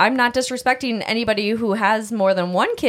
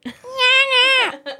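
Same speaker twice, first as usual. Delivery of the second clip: high laugh. Real, not acted. Laughs at own mom voice.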